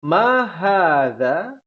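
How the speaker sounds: slow rising "Ma hadza?" for the class to repeat; drawn-out "Ma hadza?" with a rising end, read for group echo; teacher reads "Ma hadza?" slowly for the students to echo; slow model reading. Shown as a demonstration. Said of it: "Ana akan bacakan, ikhwan sekalian bisa ikuti berjamaah".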